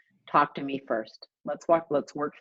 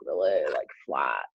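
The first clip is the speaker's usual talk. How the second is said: much lower, dead-level drone on flat; flat monotone. Retold or imitated. Showing how flat the CEO's voice was, low drone. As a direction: let the word flat sag right down. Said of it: "not a lot of undulation to his voice".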